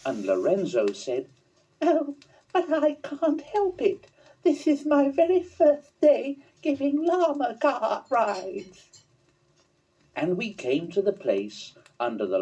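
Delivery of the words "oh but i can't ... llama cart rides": high, eager little llama voice; bubbling over with excitement as a young llama; high excited llama character voice. Acted. Lorenzo the llama speaks.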